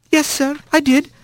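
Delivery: high polite nurse wren; eager little bird witness. A narrator's reading. Wren answers.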